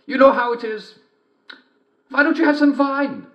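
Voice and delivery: high sing-song fake mother voice; wolf in bed pretending to be mother; wolf faking mother. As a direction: sing out sweetly to lure her in.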